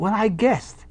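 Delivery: put-on high, lightly posh English accent; Englishman's accent. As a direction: answer as the English gent. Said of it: "the Englishman said".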